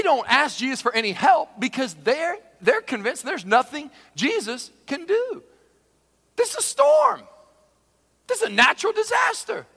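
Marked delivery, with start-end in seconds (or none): 6.3-9.6 very high-pitched exclamation; incredulous protest. Acted. Sailors convinced Jesus can do nothing, high-pitched protest. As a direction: squeal it in exasperated disbelief. Voicing sailors' disbelief at the storm.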